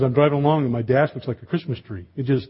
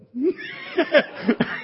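high, breathy burst of laughter. Real, not acted. Preacher laughing at his own story, a high burst of laughter.

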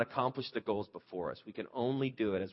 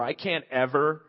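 extreme statement; 3 semitones higher, 7 dB louder than usual. Retold or imitated. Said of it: "those extreme statements like".

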